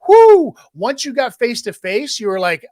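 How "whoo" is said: loud high whoop of amazement; excited whoop. Real, not acted. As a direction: let out a big excited whoo. Excited whoop about smooth talker.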